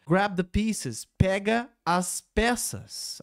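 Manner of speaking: slow, careful teaching read; slow demo. Shown as a demonstration. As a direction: lay out line and translation slowly. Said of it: "Aquele grab". (bem devagar, didático)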